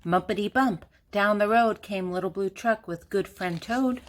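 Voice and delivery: plain reading voice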